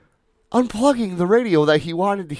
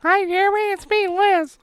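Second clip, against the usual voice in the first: high squeaky falsetto wife; hammed-up falsetto impression of his wife; mock wife voice. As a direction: play the wife in a silly high voice. Mock phone call with wife.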